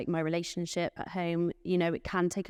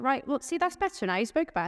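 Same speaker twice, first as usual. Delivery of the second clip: higher sing-song reassurance to staff; imitating her reassurance; 7 semitones higher, faster than usual. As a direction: brightly soothe a worried colleague. Voicing herself consoling staff.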